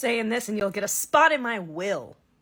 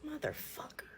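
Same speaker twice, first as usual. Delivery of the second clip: whisper; 6 semitones higher, 18 dB quieter than usual. Retold or imitated. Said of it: "he goes".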